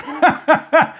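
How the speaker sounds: short high giggle; a quick amused laugh at himself; light laugh. Real, not acted. Laughing at himself over meeting strangers, short high giggle.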